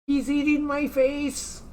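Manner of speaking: high, slow hammed-up wail; mock panic. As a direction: ham up being eaten alive. Dog licking his face.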